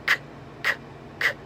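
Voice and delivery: three short k clicks, tongue back pressed to palate; K sounds. Shown as a demonstration. Teacher showing the K sound to close the nose. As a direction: click out k, k, k, briefly. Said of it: "if you make a K sound".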